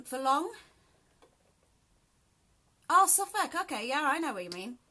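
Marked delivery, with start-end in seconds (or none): 2.9-3.5 high, loud delighted oh; pleased surprise. Real, not acted. Reading a viewer's reply with high loud surprise. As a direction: sound happily surprised.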